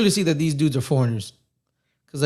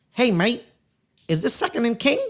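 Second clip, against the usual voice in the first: higher-pitched mock Australian, rising question; horrible Australian accent; 8 semitones higher than usual. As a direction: badly imitate an Australian asking directions. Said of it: "That's my horrible Australian accent".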